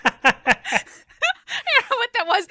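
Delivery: laughing through the words, slow and high; giggling, embarrassed and amused. Real, not acted. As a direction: crack up at your own bad impression. Laughing at own bad accent.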